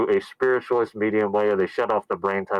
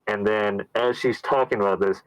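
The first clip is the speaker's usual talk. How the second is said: ordinary delivery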